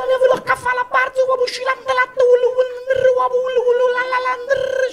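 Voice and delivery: very high, loud monotone caricature; ringtone-like chatter. Retold or imitated. Young men chatting under the building at night, mock ringtone chatter. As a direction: rattle it out high like a ringing phone. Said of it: "וזה נשמע כמו רינגטונים בטלפון".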